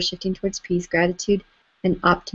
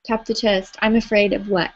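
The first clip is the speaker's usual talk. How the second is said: usual speaking voice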